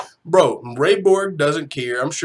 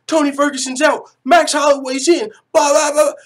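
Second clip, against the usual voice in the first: high, loud rapid-fire headlines; mock breaking-news shout; 8 semitones higher, 5 dB louder than usual. Pitching the week as a movie, shouted mock headlines.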